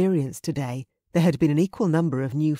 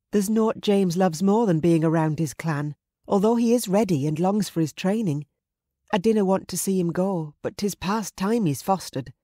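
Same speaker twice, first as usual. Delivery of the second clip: higher-pitched Scottish mother character; fond mother; 4 semitones higher than usual. Acted. Mother telling her companion about her son, fond higher voice. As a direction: play a mother reluctant to let go.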